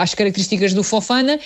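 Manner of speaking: ordinary talk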